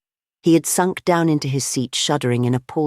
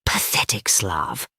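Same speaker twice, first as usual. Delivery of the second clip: slow, breathless mocking whisper; 9 semitones lower, 5 dB quieter, slower than usual. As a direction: hiss the insult under the breath. President mocks the unmasked spy.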